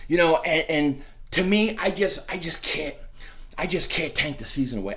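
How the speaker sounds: normal talking voice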